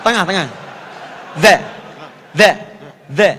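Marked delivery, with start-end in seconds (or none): 1.4-3.3 "The" as a voiced th, tongue tip between the teeth; short separate drill calls of "the"; tongue lightly bitten. Shown as a demonstration. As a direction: model "the" with the tongue gently bitten. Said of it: "gigit lidahnya sedikit".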